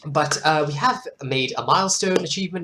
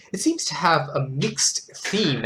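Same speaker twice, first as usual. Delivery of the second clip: ordinary talk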